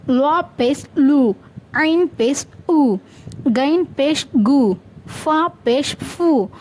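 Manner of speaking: slow, sing-song spelling drill; letter drill recitation. Shown as a demonstration. Teacher models Arabic letters with pesh for learners, slow measured recitation. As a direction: recite each letter deliberately, dropping on the final syllable.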